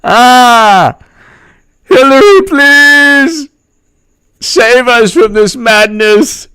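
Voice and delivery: high, loud, slow hammed-up wail for help; mock desperate plea. Mocking a despairing fan crying out to Hillary, high and loud. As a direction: beg dramatically, hamming it up.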